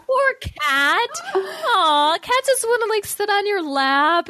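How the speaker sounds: high, laughing sympathy for the cat; giggling through pity for the poor cat. Real, not acted. Host laughing to co-hosts about kicked cat, giggling.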